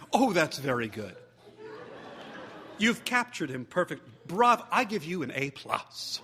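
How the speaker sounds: lightly voiced teacher praising through laughter; laughing praise. A narrator's reading. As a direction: gush it out while still laughing. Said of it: "Miss Chestnut laughed".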